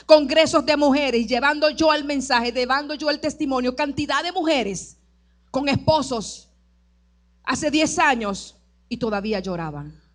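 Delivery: normal talking voice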